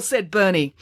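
usual speaking voice

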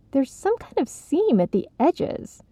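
eager discovery; bright with excitement; excited. Acted. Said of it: "with excitement".

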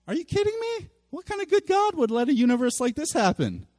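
high-pitched, disbelieving challenge; acted incredulity. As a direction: question God in mock outrage. Voicing an angry doubter of God.

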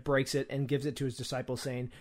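ordinary talk, nothing marked

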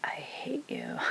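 breathy, airless "I hate you", voicing a glare; imitating a silent glare in a whisper; whispered mock hatred. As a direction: hiss it silently like a sulking kid. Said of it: "he just stared at me like".

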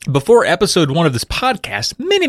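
ordinary delivery

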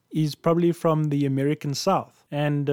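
ordinary talk, nothing marked

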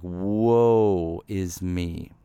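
very slow, heavy woe; slow lament. Acted. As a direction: stretch it out, mourning. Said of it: "it's just like".

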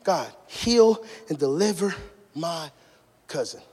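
slow, low, soft plea; retold plea sinking to a hush; begging prayer. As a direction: beg God quietly, word by word. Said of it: "begging God".

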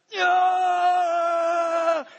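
a huge, high joyful yell of victory; ecstatic cheer. Retold or imitated. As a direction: leap up and scream yeah for the winning shot. Cheering Jordan's winning shot.

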